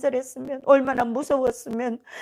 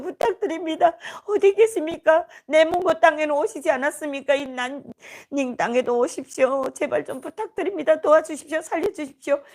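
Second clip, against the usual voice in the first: urgent, raised-pitch retold pleading; tearful crying-out prayer; 4 semitones higher than usual. Prisoners praying through tears in jail, imploring wail. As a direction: plead with God in a tumbling lament. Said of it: "눈물로 통곡하고 기도하고".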